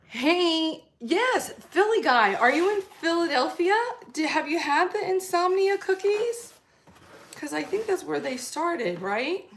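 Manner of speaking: high, loud, bright hello to a viewer; greeting the Philly viewer in chat, loud and excited. Real, not acted. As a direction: call out to the chat, thrilled. Greeting a live chatter.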